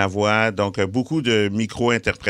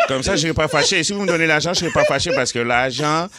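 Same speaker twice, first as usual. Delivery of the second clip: put-on comic bargaining in a higher Haitian accent; 5 semitones higher than usual. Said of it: "jaser avec un accent haïtien ou en créole". (accent haïtien, marchandage comique)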